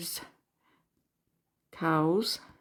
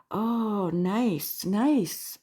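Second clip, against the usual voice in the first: normal talking voice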